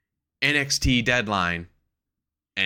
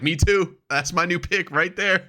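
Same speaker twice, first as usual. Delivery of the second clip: retold excitement; 9 semitones higher, 3 dB louder than usual. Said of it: "I was like".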